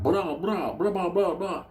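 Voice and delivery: high, sharp vocal gunfire; mock rifle fire with the voice; imitated gunshots. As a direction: spit out the rifle shots.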